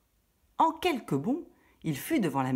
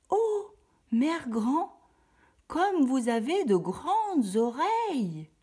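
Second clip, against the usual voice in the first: much higher, slow amazed voice; wondering little girl; 10 semitones higher, 3 dB louder, much slower than usual. Acted. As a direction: marvel at grandma's big eyes. La fillette s'étonne. (étonnement lent et aigu de la fillette)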